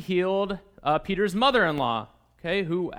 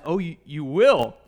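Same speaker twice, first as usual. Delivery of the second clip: slow, louder questioning of a promise; sceptical challenge; 3 semitones lower, 5 dB louder, slower than usual. Acted. Jesus replies to the professor's pledge, sceptical challenge. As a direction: draw it out, doubting the pledge.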